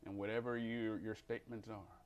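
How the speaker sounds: normal talking voice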